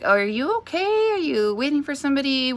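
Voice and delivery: high-pitched, puzzled checking-in; retold concern, pitched well up; concerned questioning. Mom asks the woman if she's okay, concerned questioning.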